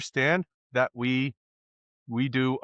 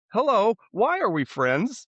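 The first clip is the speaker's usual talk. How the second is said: Boris voice; 7 semitones higher, slower than usual. Retold or imitated. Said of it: "[name] doing Boris voice".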